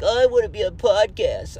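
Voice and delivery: high, whiny mock complaint; mock whining. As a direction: whine it like a spoiled kid, hammed-up. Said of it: "This isn't me whining".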